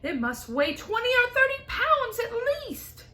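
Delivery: high, loud scolding yell; angry yell. Acted. Aunt yelling at James to leave the trunk, angry. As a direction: bark it up high at the boy.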